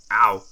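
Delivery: sharp loud ow of pain; sudden cry of pain; pained yelp. Acted. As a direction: yelp as the headache strikes. Sudden jolt of head pain.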